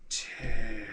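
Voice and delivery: soft, distant murmur of the name; a hint of a faint ghost. Lightly voiced ghost murmuring his name to the player. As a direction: say it quietly, half lost in thought.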